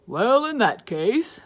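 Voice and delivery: lightly voiced principal.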